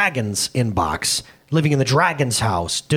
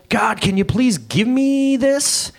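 whiny pleading to God; 9 semitones higher, slower than usual. Retold or imitated. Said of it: "And I'm going".

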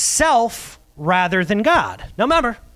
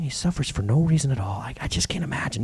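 hushed whisper; 6 semitones lower, 6 dB quieter than usual. Acted. Pastor whispering Job's plight to the congregation. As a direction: whisper it, heavy with pity.

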